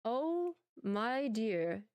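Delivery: ordinary talk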